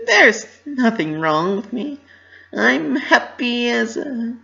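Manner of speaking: high, loud mock-innocent pony voice; Pinky playing dumb. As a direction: brush it off with fake brightness. Pinky insisting she is fine.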